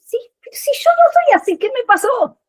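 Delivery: a startled, high "what happened to me?"; bewildered. Acted. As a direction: ask it like you can't believe it's you. Puzzled inner voice, acted out.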